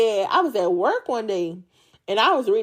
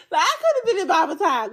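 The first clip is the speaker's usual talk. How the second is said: laughing; 7 semitones higher than usual. Real, not acted.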